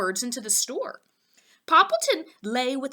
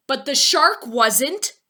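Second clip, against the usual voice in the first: louder pointed reply; wry Poppleton; 4 semitones higher, 7 dB louder than usual. A narrator's reading. Poppleton reminding Fillmore of the shark, louder. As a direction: land it dryly.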